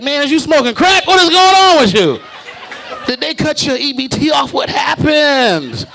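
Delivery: high, loud, disbelieving yell. Retold or imitated. Brooklyn friend shocked by his weight loss, high mock shout. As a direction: holler it like your boy can't believe his eyes.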